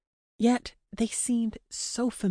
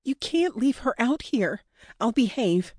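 higher-pitched urgent plea; pleading; 5 semitones higher than usual. Acted. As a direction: beg the captor on a friend's behalf. Captive pleads for her friend.

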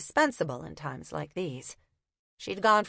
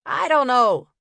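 loud, higher, bitten-off words; bitten-out anger; 6 semitones higher, 9 dB louder than usual. Acted. Fed-up copper snapping at Una, bitten-out anger. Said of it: "he bit out".

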